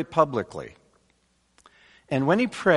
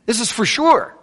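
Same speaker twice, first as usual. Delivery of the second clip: "sure" driven high and hard; emphatic certainty; 8 semitones higher, 4 dB louder than usual. Acted. Preacher voicing the psalmist's confidence, emphatic and high. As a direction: insist on it, voice leaping up on "sure". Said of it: "he gets emphatic about it".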